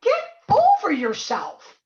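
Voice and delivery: fed-up laughing scoff at the doom talk; half-laughing, loud exasperated retort; carried away. Real, not acted. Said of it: "I probably got a little bit carried away".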